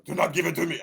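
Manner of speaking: put-on loud, high-pitched French accent. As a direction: slip into a hammy French accent. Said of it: "I don't know why I did that".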